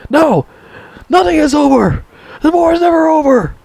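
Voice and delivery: high, strained mock yell; Rambo, anguished outburst. Rambo refusing the colonel's order, anguished high outburst. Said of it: "He tells Rambo".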